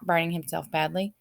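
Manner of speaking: ordinary delivery, nothing marked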